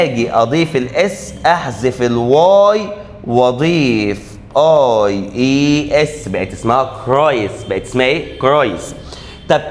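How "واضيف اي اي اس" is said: slow spelling out. Shown as a demonstration. Teacher spelling the ending slowly for students. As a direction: spell each letter out very slowly.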